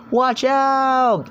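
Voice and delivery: high, loud warning scream. Acted. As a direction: yell it out to warn of the rockslide. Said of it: "someone screaming".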